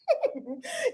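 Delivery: soft, high giggle; laughing. Real, not acted. Host amused by own mock wailing, soft giggle. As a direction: giggle quietly at your own act.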